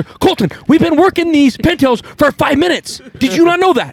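hammed-up exasperated scolding; mock scolding grandfather. Grandfather scolding the boy for spoiling the hunt, mock angry.

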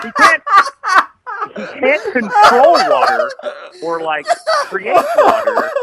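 high, laughing words; cracking up while explaining. Real, not acted. Laughing at silly character idea.

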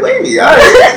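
squealing excited praise; hyped laughing shout. Retold or imitated. Friends hyping new glasses.

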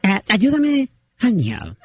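put-on low gruff old dad repeating slowly; old father voice. Prank caller as the father repeating when asked to slow down, low gruff. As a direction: drag the words out as the father. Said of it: "say that a little slower".

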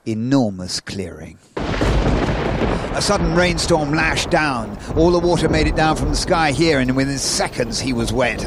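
dramatic stage narration; story narration. Acted. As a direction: tell it as a tense adventure tale. Reads the story aloud in performance.

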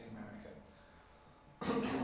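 hoarse, strained, fading voice; a voice that is giving out; losing voice. Real, not acted. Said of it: "Sorry, I'm losing my voice".

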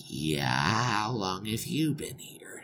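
low, slow, breath-held whisper; stunned hush. Acted. Groom to unexpected bride, stunned whisper. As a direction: ask carefully, barely voiced.

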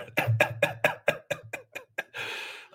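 high airy gleeful cackle; a wicked little giggle. Real, not acted. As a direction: cackle with delight at the thought. Gloating over a nuke fantasy.

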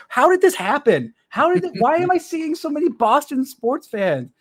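high, slow, baffled outcry; bewildered self. Retold or imitated. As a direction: act dumbfounded at your feed. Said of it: "I'm like".

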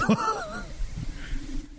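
high squealing laugh; soft, helpless high laugh; laughing fit. Real, not acted. Host cracking up at the ass-kissing joke, high laughing fit.

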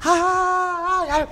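high, wailing mock scream; imitated woman's scream. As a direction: shriek like a startled kitchen lady. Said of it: "una señora grita y dice". (grito agudo imitando a una señora)

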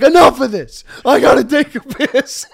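loud, high imitation of a fed-up friend; fed-up yell. Friend who has turned on him, fed-up yell. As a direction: yell it like you have had it.

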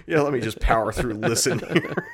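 laughing. Real, not acted. Joking about rushing the podcast.

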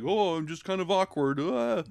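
bumbling awkward. Acted. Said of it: "bumbling sort of basic comedic lead".

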